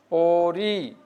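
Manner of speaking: পড়ি stretched, its final ই held; drawn-out ending. Shown as a demonstration. Teacher showing students how to pronounce it, পড়ি drawn out. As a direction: hold the last vowel of পড়ি long. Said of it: "তোমরা এভাবে টানে উচ্চারণ".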